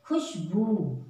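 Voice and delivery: खुशबू with a quick खु and a long बू; quick kh, long boo. Shown as a demonstration. Teacher to children: खुशबू with a quick खु. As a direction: rush खु, then hold बू. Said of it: "ख को हम जल्दी बोल रहे हैं".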